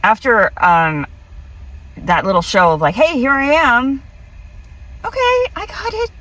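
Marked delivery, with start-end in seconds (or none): 3.0-6.1 mock dialogue. Retelling the hybrid's show.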